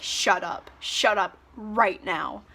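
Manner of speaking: pissed off. Retold or imitated. Reader voices Hardin getting really pissed, understated anger. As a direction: act out a restrained, slowing order to stop. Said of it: "getting really pissed".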